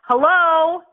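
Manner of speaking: loud, high, challenging hello. Acted. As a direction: call it out like knocking on a door. Calling out to wake listener.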